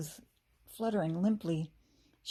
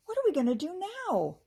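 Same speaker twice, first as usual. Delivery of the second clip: high, fast panicky question; panicked; 11 semitones higher, 4 dB louder, faster than usual. A narrator's reading. Kirsty lost in the maze, panicking. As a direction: blurt it out in a panic. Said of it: "Kirsty cried".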